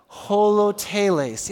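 holoteles slow and clear, ho-lo-te-les; teaching pronunciation. Shown as a demonstration. Pastor leading congregation to repeat the word, slow. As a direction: say holoteles slowly, syllable by syllable. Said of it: "Say it one more time".

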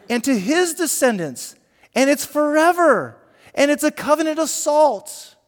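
high, loud preaching rebuke. Acted. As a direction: thunder it at the rebels. Abijah rebuking Jeroboam in speech.

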